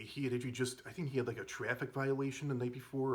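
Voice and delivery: ordinary talk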